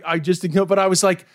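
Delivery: normal talking voice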